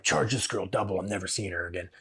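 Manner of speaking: a quiet, deadpan joke to the front desk; joking aside. Pretend. To the front desk about the tourist client, a quiet joking aside. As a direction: mutter it like a sneaky aside. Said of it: "No, no, no, I'm kidding".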